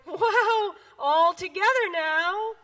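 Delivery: high laughing cheer; frog cries out laughing. Acted. Frog calls all the animals together, laughing. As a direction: laugh and call everyone to dance. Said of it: "frog cried".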